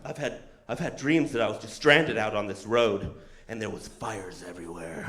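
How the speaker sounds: low, eerie recounting; ominous driver. Retold or imitated. Subaru driver telling passenger her dreams, ominous.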